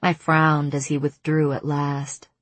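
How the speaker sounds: usual speaking voice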